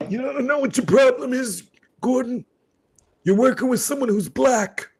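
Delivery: squeaky mock policeman; cop impression. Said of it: "I could do his voice like this".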